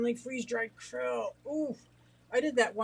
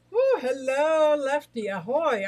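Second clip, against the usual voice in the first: excited greeting; 5 semitones higher, 8 dB louder, slower than usual. Real, not acted. Greeting chat viewers excitedly.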